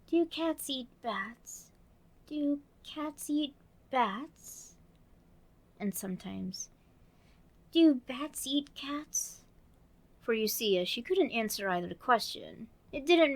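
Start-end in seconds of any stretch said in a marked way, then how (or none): 0.1-4.8 slow, drowsy repetition; dreamy. Acted. Alice falling asleep, murmuring dreamily. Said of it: "in a dreamy sort of way". 7.7-9.4 sleepy slow whisper; dreamy whisper. Acted. Alice dozing off, whispering dreamily. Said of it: "in a dreamy sort of way".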